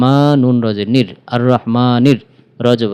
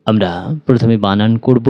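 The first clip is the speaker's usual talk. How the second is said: normal talking voice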